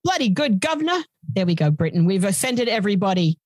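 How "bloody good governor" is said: hammed-up English accent, pitched higher; a cheesy American stab at an English accent; bad English accent. Joking to a cohost in a terrible English accent. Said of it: "my best attempt at an English accent".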